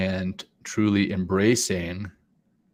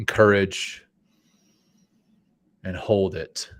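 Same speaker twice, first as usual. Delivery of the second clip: guided breathing. Acted. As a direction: lead the breaths gently. Leads breathing exercise.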